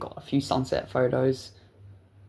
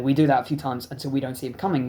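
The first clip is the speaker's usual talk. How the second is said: ordinary talk, nothing marked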